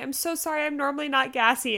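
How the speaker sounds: retold pleading niceness; embarrassed apology. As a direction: apologize politely to nurses. Apologizing to nurses.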